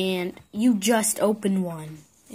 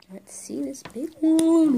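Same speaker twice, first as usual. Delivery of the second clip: whispering; 6 semitones higher, 4 dB louder, slower than usual. Real, not acted. Opening toy egg with toddler.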